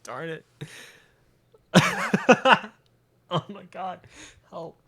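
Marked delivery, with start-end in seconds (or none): 1.7-2.7 high squeal of laughter; real giggle squeal. Real, not acted. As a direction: shriek a laugh out. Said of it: "I can't stop laughing now".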